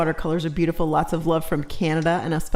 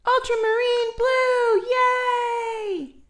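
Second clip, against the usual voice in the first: excited cheer; much slower than usual. Real, not acted. Excited cheer at color name.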